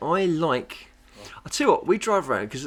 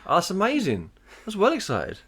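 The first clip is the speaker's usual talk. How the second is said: usual speaking voice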